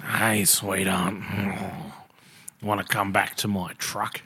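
slow, low grunted pickup line; pub dude grunt. Retold or imitated. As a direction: drawl it like a bloke who never looks up. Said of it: "a dude in a pub who hasn't looked up".

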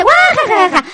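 high, spelled-out mock laugh; read-out laughter. Host reading a listener's message aloud, mock laughing. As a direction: act out a typed belly laugh. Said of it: "Rodrigo Pozo dice".

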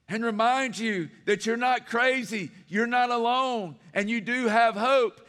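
loud, lifted pulpit declaration; preached reassurance. Acted. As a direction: proclaim it to the church. Preached encouragement to congregation.

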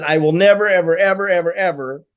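string out the "evers" in mock insistence; mimicked emphatic refusal. Mimics client's earlier refusal.

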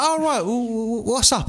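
high slow call through the door; stepdad calling. Retold or imitated. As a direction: lightly mimic the cheery stepdad calling in. Stepdad voiced in story.

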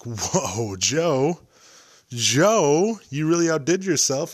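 hammed-up wow; mock amazed fans. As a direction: play the dazzled listener, half-joking. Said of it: "You guys are gonna be like".

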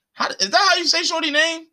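high-pitched doubtful check; puzzled aside. Real, not acted. Breaks off, doubting the name.

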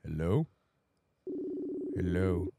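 ordinary talk

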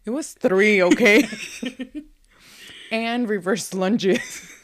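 loud playful indignation; loud laughing defense. Real, not acted. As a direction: defend yourself loudly through a laugh. Defending her workout, laughing.

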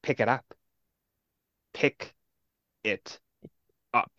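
quiet threat with long pauses between words; menacing villain. Retold or imitated. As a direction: imitate a chilling, unhurried villain. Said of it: "he's just like".

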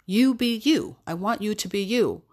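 ordinary talk, nothing marked

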